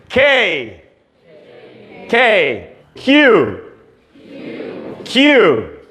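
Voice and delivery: H to Y, each said twice, clearly the English way; English letter names. Shown as a demonstration. Teacher drills the English alphabet with the class. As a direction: say it, then say it again. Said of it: "repita comigo".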